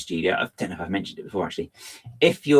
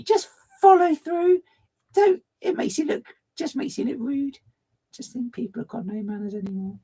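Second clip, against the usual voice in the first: mock prim lady. Streamer ranting about manners as a pearl-clutching old lady, very high. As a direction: scold like a scandalised old lady.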